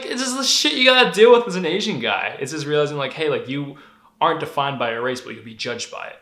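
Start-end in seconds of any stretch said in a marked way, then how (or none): 0.0-2.4 high, louder quoted voice; quoting girlfriend. Retold or imitated. Girlfriend telling him how it is, quoted higher. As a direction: give it her matter-of-fact tone, raised.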